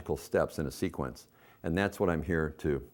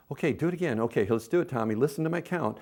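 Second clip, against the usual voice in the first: put-on higher, playful prompt to a toddler; coaxing mom voice; 6 semitones higher than usual. Shown as a demonstration. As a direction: encourage Tommy to listen. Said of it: "You count".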